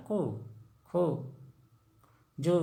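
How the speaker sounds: कु, खु, जु each with a brief उ; brief separate syllables कु, खु, जु; short, gentle u. Shown as a demonstration. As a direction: say कु, खु, जु short, with pauses between. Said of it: "मतलब धीरे से उच्चारण होता है".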